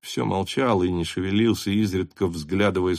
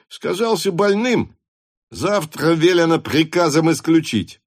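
high-pitched hussar voice, getting louder; excitable Denisov; 9 semitones higher, 4 dB louder than usual. Acted. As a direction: report it in a high, ringing voice. (высокий, запальчивый голос)

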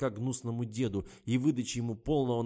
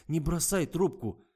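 pleading; 8 semitones higher, 7 dB louder, slower than usual. A narrator's reading. Lena begs him not to hang up.

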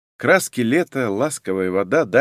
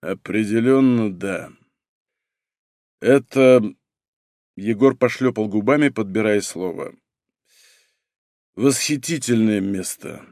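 put-on drunk slur; 3 semitones lower than usual. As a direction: babble it with a thick tongue. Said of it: "заплетающимся языком пролепетал он".

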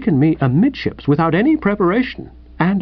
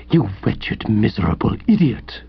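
low, seething insult; contained fury; 5 semitones lower than usual. A narrator's reading. Host to the intruder, low contained fury. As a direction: drop the pitch and seethe.

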